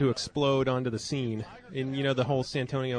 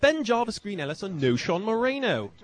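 higher, mock British accent reading names; English accent; 6 semitones higher than usual. Repeating the host's names, mock English accent. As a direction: keep the put-on English accent going. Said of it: "you gotta do it in an English accent".

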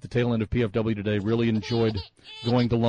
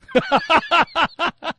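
high burst of laughter; hearty laugh; 13 semitones higher, 4 dB louder than usual. Real, not acted. Host laughing at musket customs joke, hearty laugh. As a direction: crack up at the joke.

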